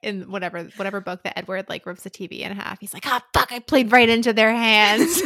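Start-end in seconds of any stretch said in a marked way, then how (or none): 3.1-5.2 loud, drawn-out exasperated curse; slow, loud, hammed-up dismay; mock furious outburst. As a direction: play a thwarted character cursing in frustration. Imagined character's furious outburst over film.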